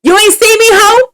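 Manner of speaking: high, loud exaggerated Miami accent; hammed-up Miami accent, calling her out. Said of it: "your Miami accent".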